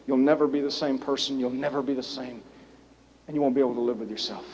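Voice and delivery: low, soft comforting; wife comforting her husband softly; tender reassurance. Retold or imitated. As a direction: reassure him gently. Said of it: "she looks at him and says".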